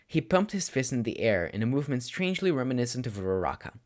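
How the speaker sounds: plain reading voice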